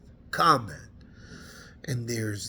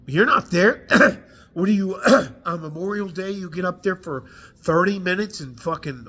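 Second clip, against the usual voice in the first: furious outburst; 2 semitones higher, 6 dB louder than usual. Real, not acted. Veteran blasting leaders who never served, furious. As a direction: lash out at the Memorial Day speeches.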